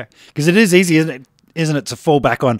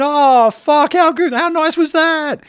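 high, delighted outburst; amazed exclamation; 13 semitones higher, 4 dB louder, slower than usual. Retold or imitated. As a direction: blurt it out, blown away. Bass player amazed after the solo.

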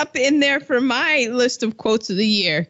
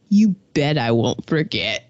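low, ominous movie quote; chilling line; 10 semitones lower than usual. Retold or imitated. Podcaster quoting old Gina's spine-chilling line, low and ominous. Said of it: "it put chills down my spine".

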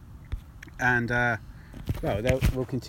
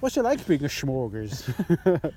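Steve McLaren impression; slower than usual. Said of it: "sound so much like Steve McLaren".

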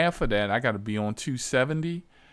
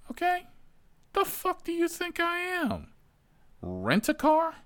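very high outraged squeal. Real, not acted. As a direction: shoot way up in incredulous outrage. Outraged at passenger.

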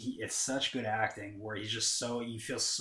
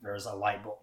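ordinary delivery

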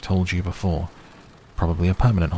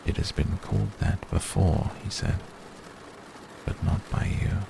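grave and quiet; 5 dB quieter than usual. A narrator's reading. Grave wizard, alarmed.

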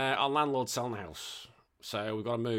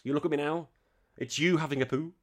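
ordinary talk

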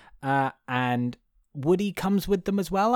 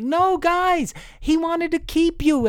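much higher pleading cartoon voice; pleading Woody; 3 dB louder, slower than usual. Podcast host voices Woody begging the toys, high. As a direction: beg them high and urgent. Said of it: "and he's like".

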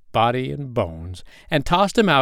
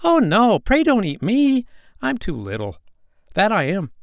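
high, pleading tiny goat; small goat voice; 10 semitones higher, 3 dB louder than usual. A narrator's reading. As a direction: beg the troll as a little goat. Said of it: "with such a small voice".

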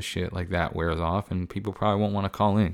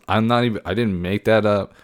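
usual speaking voice

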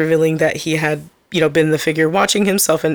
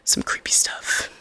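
near-whisper, much softer; eerie hush; 12 dB quieter than usual. Real, not acted. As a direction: close the creepy tale in a whisper. Eerie end of plot summary.

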